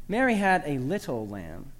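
"little" picked out with extra weight; stress on LITTLE. Shown as a demonstration. Teacher making much of tiny stature, stress on "little". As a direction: lean hard on "little". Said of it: "Emphasize the fourth word".

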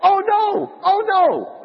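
high, loud cry of dismay; screaming out. Acted. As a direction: scream it out as the horrified monk in a joke. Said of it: "Brother Andrew screams out".

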